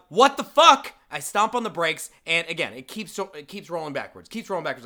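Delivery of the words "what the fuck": high, loud exclamation; reenacted startled yell; retold alarmed outburst. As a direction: burst out in alarm. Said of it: "so I say aloud".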